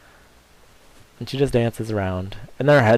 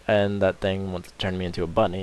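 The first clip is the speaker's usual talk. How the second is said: normal talking voice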